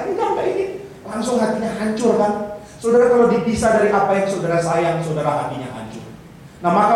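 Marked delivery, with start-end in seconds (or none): 0.0-0.7 put-on girlfriend's flat refusal. Acted breakup quarrel.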